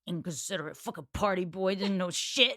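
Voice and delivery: low, disgruntled put-on muttering; mock grumbling. Knightley complaining about Frank Churchill, low grumbling. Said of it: "grumbling about how Frank is".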